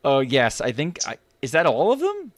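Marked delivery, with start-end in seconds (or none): 1.4-2.3 high, loud, taken-aback question; incredulous, voice raised; surprised question. Real, not acted. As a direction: blurt it out in disbelief, pitch jumping up. Surprised at the short list.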